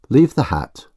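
clearly spoken through a cloth; distinct through cloth. Acted. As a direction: enunciate each word behind a cloth. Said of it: "speaking distinctly through the cloth".

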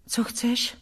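soft, hesitant question; small, apprehensive voice; timid, embarrassed. A narrator's reading. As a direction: ask it shyly and fearfully. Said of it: "zeptala jsem se rozpačitě a bojácně".

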